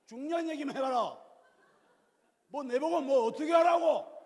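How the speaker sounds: mock exasperated shout; irritated retort. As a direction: cut your partner off. Bad couple's impatient retort.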